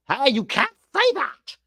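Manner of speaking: high mock scolding; a light put-on high voice telling off; lightly voiced scolding broadcaster; mock Bretos. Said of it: "as per Max Bretos".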